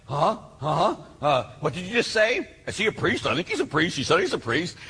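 mock bewildered double-takes; waking up startled. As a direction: imitate puzzled teens jolted awake. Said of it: "they all wake up like".

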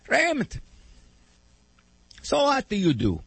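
an Italian grandpa curious about the job; Italian accent. Retold or imitated. Grandfather asking grandson his job, Italian accent. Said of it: "in his broken Italian accent".

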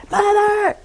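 high, loud call of Mother. Retold or imitated. Someone shouting for her across the print shop. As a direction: yell for mother from across the house. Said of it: "And somebody said".

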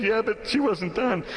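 high, drawn-out mock distress; imitated sobbing woman. Mother telling the pastor about the prayer, mock distress. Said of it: "she's just sobbing".

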